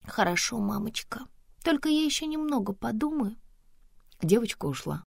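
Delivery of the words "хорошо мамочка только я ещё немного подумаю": put-on high, softened child voice; obedient little girl. Daughter answering mama at bedtime, meekly. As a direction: agree meekly, pitched up. Small daughter agreeing to go to bed.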